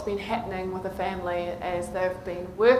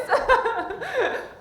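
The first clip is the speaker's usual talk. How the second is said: bright high burst of laughter; amused high laugh; 11 semitones higher than usual. Real, not acted. Laughs at own example.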